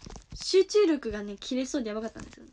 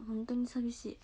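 whispered loneliness; 2 semitones lower, 8 dB quieter than usual. Real, not acted. Missing her group members.

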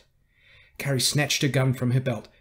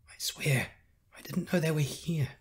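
higher-pitched, pleading whimper; 6 semitones higher than usual. A narrator's reading. Accused old man swearing innocence to Carrie, whimpering. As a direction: plead weakly, voice creeping up. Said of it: "he whimpered".

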